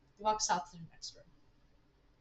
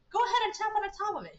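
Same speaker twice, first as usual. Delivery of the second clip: high, quick, louder character voice; eager urging; 11 semitones higher, 5 dB louder, faster than usual. Acted. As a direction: urge them on briskly, pitch up. Stanford eagerly invites a test.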